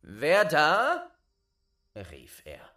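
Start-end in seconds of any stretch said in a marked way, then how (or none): 0.0-1.1 loud, high challenge into the dark; calling out. Acted. Boy calling out into the dark tower. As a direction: shout it up the dark stairs.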